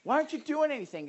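high-pitched mock complaint; crying out. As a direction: plead in acted exasperation. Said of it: "you're crying out like".

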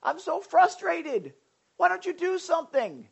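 high, loud mock plea to God; crying out. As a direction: cry out in hammed-up frustration. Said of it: "you're crying out like".

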